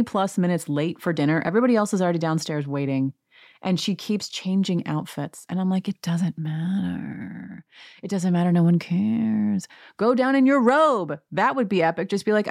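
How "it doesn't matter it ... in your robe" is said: exasperated, stretching the words out; mock exasperation. Exasperated plea to dawdling Kyle.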